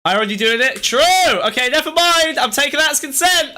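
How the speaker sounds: high-pitched, loud off-the-cuff holler; calling out. Real, not acted. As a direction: call back loudly to a distant partner. Shouted exchange with partner off mic.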